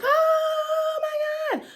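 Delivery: very high, slow, drawn-out fangirl shriek; mock starstruck squeal. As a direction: gush slowly like a starstruck fan meeting a star. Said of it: "I'm not gonna be like".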